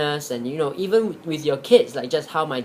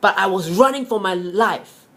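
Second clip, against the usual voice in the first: higher and louder, building fervour toward for my life; proud, passionate telling of the escape; injured rabbit telling his family how he outran the dogs; emphatic rabbit; 6 semitones higher, 5 dB louder than usual. Acted. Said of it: "the rabbit said".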